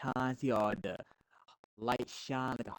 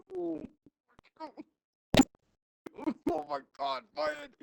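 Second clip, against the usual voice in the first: mock shock; 4 dB louder than usual. Retold shocked exclamation after cough.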